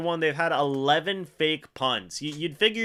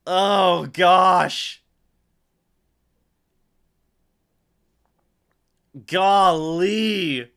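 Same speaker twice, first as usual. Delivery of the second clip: stunned groan; 5 dB louder, much slower than usual. Real, not acted. Reeling after the fake punt.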